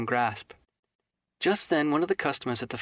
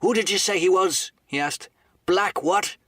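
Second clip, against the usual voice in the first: higher, louder sharp questions; gruff innkeeper; 6 semitones higher, 5 dB louder than usual. Acted. Silver presses the boy about the name, sharply. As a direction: demand the name again.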